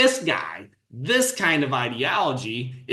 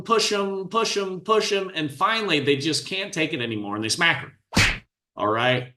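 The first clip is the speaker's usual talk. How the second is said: usual speaking voice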